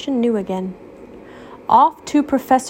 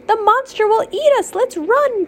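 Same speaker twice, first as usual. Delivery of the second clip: panicked Toast; 13 semitones higher than usual. Acted. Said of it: "Toast declared".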